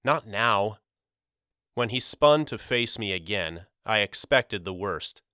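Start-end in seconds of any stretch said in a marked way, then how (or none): 0.0-0.8 mild, anxious-to-persuade plea; pleading. A narrator's reading. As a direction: plead briefly, lightly pressing. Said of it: "I pleaded".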